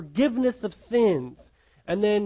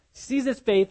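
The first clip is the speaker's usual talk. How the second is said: ordinary delivery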